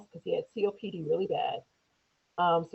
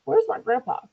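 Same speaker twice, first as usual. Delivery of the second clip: lightly voiced child, pitched up; young son's voice; 6 semitones higher, slower than usual. Son asking mom for his grandpa, lightly voiced child. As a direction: imitate a kid asking out of the blue. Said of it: "comes out the blue and says".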